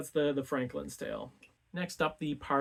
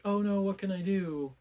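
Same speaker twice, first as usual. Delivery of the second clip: higher-pitched retold "oh no" panic; imitated helpless fretting; mock dismay; 6 semitones higher, 3 dB louder than usual. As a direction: lightly act out a suitor at a loss. Suitor dismayed by impossible task.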